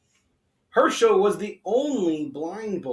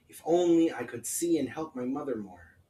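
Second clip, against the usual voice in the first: ordinary delivery, nothing marked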